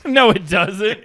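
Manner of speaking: slow no said through laughter; laughing denial. Real, not acted. Friend laughing as he denies the charisma boost. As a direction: laugh as you deny it.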